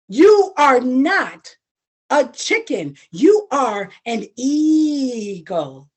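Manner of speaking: each word slow and weighty; slow, grave words to the little eagle; slow solemn declaration. Acted. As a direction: proclaim it slowly, like a solemn truth. Brother's solemn declaration again.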